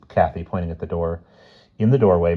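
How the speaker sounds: ordinary delivery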